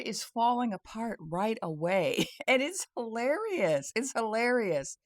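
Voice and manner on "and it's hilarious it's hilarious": high, gleeful amusement; laugh, then pitch it up in delight. Real, not acted. Vlogger delighted their story is falling apart, laughing. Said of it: "So that's why I'm laughing".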